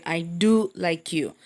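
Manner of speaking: slow, with weight on "do"; teacher's measured demo of an emphatic "do"; stress on DO. Shown as a demonstration. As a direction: lengthen "do" to show emphasis. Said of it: "I'm stressing the word".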